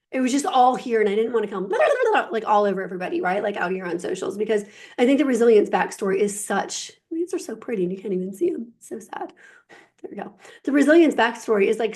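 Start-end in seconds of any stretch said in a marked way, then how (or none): 1.7-2.3 high, dismissive mock babble; mocking blah blah. Coach mocking her own oversharing online, high mock babble. 8.8-10.3 soft breathy aside to herself; whispered aside. Real, not acted. Coach fiddling off-mic, whispered aside to herself. Murmured aside while adjusting something.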